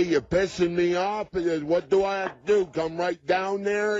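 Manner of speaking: normal talking voice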